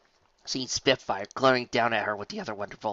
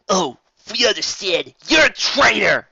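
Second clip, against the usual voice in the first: snarling accusation; 9 semitones higher, 12 dB louder than usual. Acted. Spitfire branding Rainbow Dash a traitor, loud. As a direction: bite out the insult. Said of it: "Spitfire growled, snarling".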